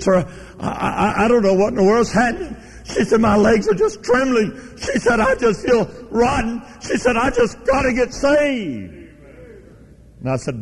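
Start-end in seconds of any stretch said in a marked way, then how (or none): 0.0-8.9 high, shaky, tearful pleading; trembling plea. Retold or imitated. A retold woman begging the preacher, trembling. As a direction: voice the woman near tears. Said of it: "Her hands were literally trembling".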